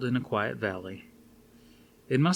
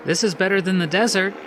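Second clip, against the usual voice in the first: higher, quick voice for Suzanne; Suzanne's voice; 10 semitones higher, 9 dB louder, faster than usual. A narrator's reading. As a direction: lift into the woman's voice, brisk.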